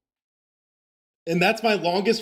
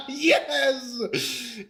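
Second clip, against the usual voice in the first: laughing; 8 semitones higher than usual. Real, not acted. Laughs after clearing part.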